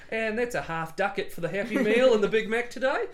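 lightly hammed-up customer ordering with a grin; a playful customer paying in ducats; mock cashier order. Customer jokes to a McDonald's cashier, mock-cheerful. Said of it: "Just get to the cashier at McDonald's".

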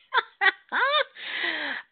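high burst of real laughter. Real, not acted.

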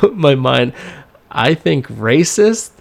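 normal talking voice

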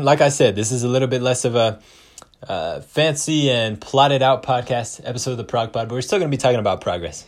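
ordinary talk, nothing marked

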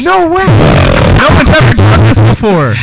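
excited. Real, not acted. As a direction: cheer it on in disbelief. Hyping a beatbox performance.

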